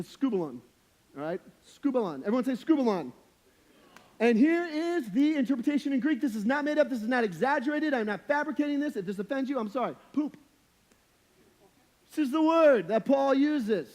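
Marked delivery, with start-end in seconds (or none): 4.2-9.0 high, loud insistent announcement; raised-voice preacher setting up a reveal; emphatic build-up. Real, not acted. Mock-solemn build-up to comic reveal. 12.1-13.9 loud, high and drawn out; comically insistent preacher shout; emphatic declaration. Real, not acted. Emphatic defence after comic reveal.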